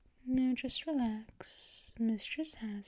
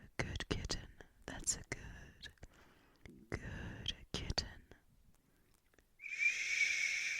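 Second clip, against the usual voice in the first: hushed, breathy praise; barely voiced, lulling them to sleep; whisper; 9 dB quieter than usual. Mistress hushing the dozing kitten, soft whisper.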